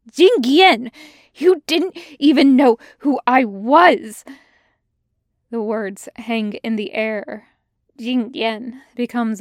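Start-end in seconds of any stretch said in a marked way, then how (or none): 0.1-4.2 loud, high, hurt outcry; anguished shout. Acted. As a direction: yell it out in pain. Xiao Shu shouts in anguish.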